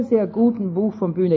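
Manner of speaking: normal talking voice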